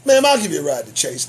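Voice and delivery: usual speaking voice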